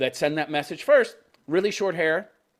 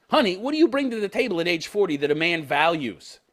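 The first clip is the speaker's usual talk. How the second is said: ordinary delivery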